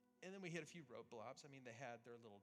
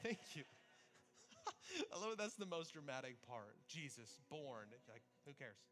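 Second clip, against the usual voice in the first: ordinary talk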